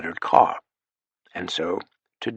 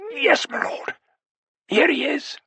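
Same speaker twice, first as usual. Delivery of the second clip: put-on a frightened old butler, pitched high and strained; the butler reporting a menacing intruder, voice tight with fear; high, tight, hesitant servant voice; strained, muted butler. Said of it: "in such a strained and muted voice".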